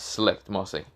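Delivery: ordinary delivery, nothing marked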